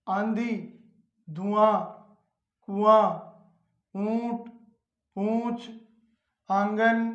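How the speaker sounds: teacher's word list, vowels let out through mouth and nose; nasalised vowel demo. Shown as a demonstration. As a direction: say each word slowly and separately with a nasalised vowel. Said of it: "अनुनासिक ध्वनियों के उच्चारण में हवा मुंह के साथ साथ नाक से भी निकलती है".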